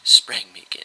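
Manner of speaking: ordinary delivery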